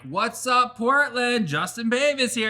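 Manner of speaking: high, hyped host greeting; signature video intro. Retold or imitated. Podcast host hyping the Portland audience, loud and high. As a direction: belt out the intro like opening a video. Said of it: "this is what I'm kind of known for".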